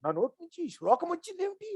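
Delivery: high, soft marvelling question; astonished. Acted. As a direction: gasp at your own words, softly amazed. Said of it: "ఆశ్చర్యపోయాడు".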